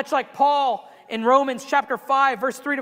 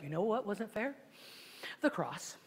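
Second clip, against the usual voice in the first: wife's dry reply; 2 semitones lower, 14 dB quieter than usual. Retold or imitated. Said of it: "she'll be like".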